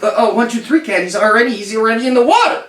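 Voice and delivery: high, hurried alarm; urgent high-pitched warning, speeding up; alarmed onlookers. Acted. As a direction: break the news in a rising panic. Acts alarmed onlookers warning French cat.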